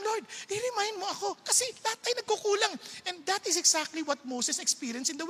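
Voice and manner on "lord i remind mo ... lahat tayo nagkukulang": hushed plea, a bit higher; whisper-soft asking of the Lord; quiet humble prayer; soft pleading prayer. Retold or imitated.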